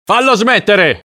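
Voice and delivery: high, desperate shout; exasperated man's high-pitched yell. A narrator's reading. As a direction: yell it at the end of your patience. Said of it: "urlò l'uomo".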